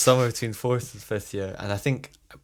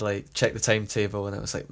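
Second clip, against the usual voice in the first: ordinary talk, nothing marked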